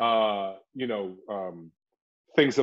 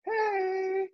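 flirty hey; 3 dB quieter than usual.

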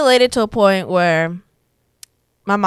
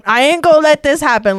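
defiant declaration; 8 semitones higher, 9 dB louder than usual. Retold or imitated. Standing up to mom.

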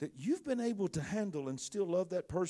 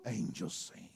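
very low, softer crooner murmur; 11 semitones lower, 8 dB quieter, slower than usual. Acted. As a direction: drop deep, as if smitten.

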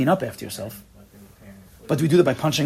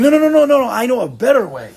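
loud, much higher rapid no no no; mock insistent protest; 10 semitones higher, 7 dB louder than usual. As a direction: push back hard like a stubborn child. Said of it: "You're like".

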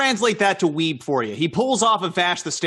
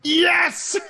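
high, elated yell; triumphant cheer; 10 semitones higher than usual. Real, not acted. Player celebrating a critical hit, high triumphant cheer. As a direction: let out a high cry of victory.

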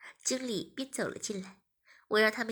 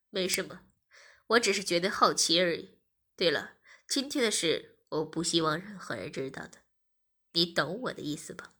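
lower-pitched man's voice; male protagonist, firm; 6 semitones lower, 4 dB louder than usual. A narrator's reading. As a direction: make it a low, firm request.

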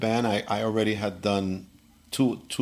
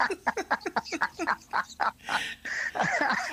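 breathy high laugh; real laughter at the memory; 4 dB quieter than usual. Real, not acted. Storyteller laughing at Miles's threat.

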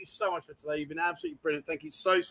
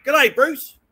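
Australian greeting, pitched up and loud; Australian accent; 5 semitones higher, 7 dB louder than usual. Shown as a demonstration. Host teaches the women to speak Australian, Australian accent. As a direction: model an Australian G'day with punch. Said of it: "They speak Australian".

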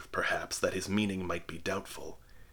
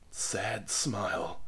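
plain reading voice

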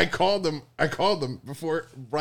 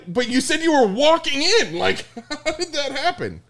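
incredulous retelling; 10 semitones higher than usual. Retold or imitated. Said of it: "and I was like".

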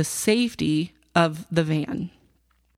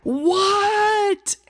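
retold scream back. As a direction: shriek it back in mock exasperation. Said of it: "I screamed back".

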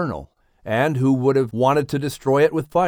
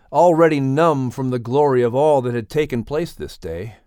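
ordinary delivery

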